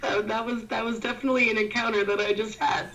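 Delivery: high and giggling; barely holding back laughter; giggly. Real, not acted. Still giggling after friend's antics.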